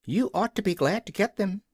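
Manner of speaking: high, clucky offended hen; a proud hen defending her eggs. A narrator's reading. Billina the hen defends her eggs to the King, high and offended.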